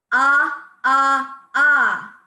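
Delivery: three loud punched ahs from the belly; loud staccato ah. Shown as a demonstration. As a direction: pump each ah out loud and equal. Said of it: "Say number three".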